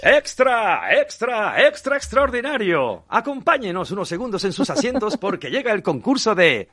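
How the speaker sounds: booming high stadium announcer shout; rapid old-time reporter. Shown as a demonstration. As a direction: cry out the news like a paperboy. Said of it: "A ver, ¿qué dices?".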